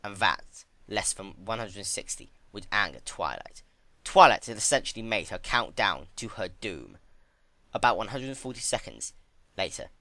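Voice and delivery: ordinary delivery, nothing marked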